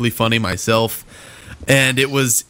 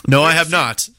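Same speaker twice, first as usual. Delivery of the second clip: ordinary talk, nothing marked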